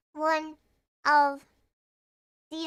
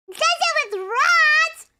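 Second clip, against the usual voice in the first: high, slow, loud squeaky voice; silly cartoon voice; 11 semitones higher, 8 dB louder, slower than usual. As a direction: stretch it out in a goofy high voice. Silly voice asking for video.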